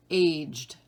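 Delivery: aged as AYJD, one syllable. Shown as a demonstration. Teacher giving the answer to number one, aged as AYJD. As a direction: say aged as a single beat, AYJD. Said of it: "that's one syllable".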